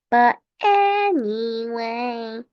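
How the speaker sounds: slow, stretched "anyway"; drawn-out repeat. Pretend. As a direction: drag out "anyway" for comic effect.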